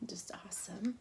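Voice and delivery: hushed unvoiced whisper to herself. Real, not acted. Baker admiring her cupcake, whispering. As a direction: whisper it under your breath. Quiet aside while decorating.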